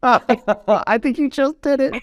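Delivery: high-pitched, laughing through the words. Real, not acted. Host teases guest amid laughter.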